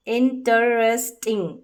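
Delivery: इंटरेस्टिंग said slowly, part by part as इन-टर-एस्ट-इंग; slow syllable demo. Shown as a demonstration. Teacher reading इंटरेस्टिंग to students in slow parts. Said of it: "इस तरीके से इंटरेस्टिंग पढ़ा".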